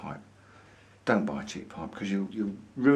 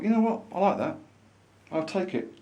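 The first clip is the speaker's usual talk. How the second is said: mock delighted inner voice; a touch of excitement; 8 semitones higher than usual. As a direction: lift the pitch higher, pleased and decided. Retelling his own thought on seeing pipe.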